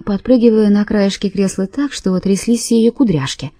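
ordinary delivery, nothing marked

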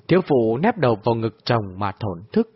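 ordinary delivery, nothing marked

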